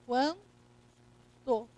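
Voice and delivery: quan, long pause, then to; quanto pronounced by syllable. Shown as a demonstration. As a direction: break quanto after the nasal vowel. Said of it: "pronúncia. Eu vou fazer isso aqui".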